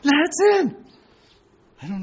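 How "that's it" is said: high-pitched mock frenzy of panicked believers; crazed alarm. Preacher mimicking Christians at the first barcodes, high-pitched frenzy. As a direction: wail it high, as if the world is ending. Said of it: "Christians went crazy".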